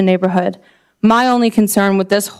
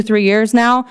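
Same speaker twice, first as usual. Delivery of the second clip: ordinary talk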